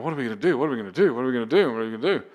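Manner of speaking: retold panic, repeated in a hushed loop; mock freaking out. Passenger retelling being pulled over, mock panic. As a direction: imitate yourself fretting over and over. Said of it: "And I'm freaking out".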